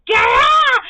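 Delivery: high, very loud retold scream; re-enacting a furious voicemail scream. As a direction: scream it out in full outrage. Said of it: "like, a screaming voicemail".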